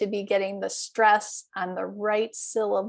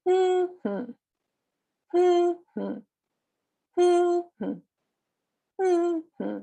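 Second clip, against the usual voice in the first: two-beat stress hum; 7 semitones higher, much slower than usual. Shown as a demonstration. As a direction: hum MM-hmm, first beat high. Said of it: "say the humming".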